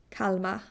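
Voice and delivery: Kalmar with the final R left suspended; barely pronounced R. Shown as a demonstration. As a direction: let the end of Kalmar hang, almost no R. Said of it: "barely pronounce the R at all".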